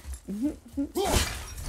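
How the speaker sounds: laugh. Real, not acted. Laughs after breathless line.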